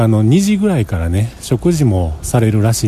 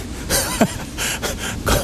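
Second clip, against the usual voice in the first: high squeal laugh; 9 dB quieter than usual. Real, not acted. Laughs at impossible schedule.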